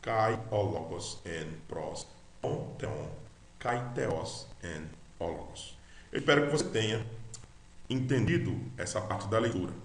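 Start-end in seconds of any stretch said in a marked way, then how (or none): 0.0-5.8 slow, softer word-by-word recitation; slow read-along. Shown as a demonstration. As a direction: repeat it slowly and quietly for learners. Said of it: "vamos repetir de novo".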